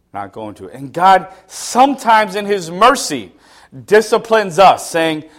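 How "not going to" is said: low, soft digging in; stubborn refusal. Retold or imitated. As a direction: quietly refuse to budge. Stubborn person refusing God.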